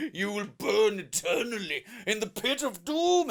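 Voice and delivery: hammed-up demonic villain voice; Satan. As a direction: proclaim it like a cartoon devil. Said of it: "Satan's there like".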